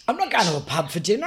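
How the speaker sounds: usual speaking voice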